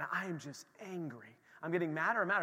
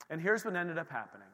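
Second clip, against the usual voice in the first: ordinary talk, nothing marked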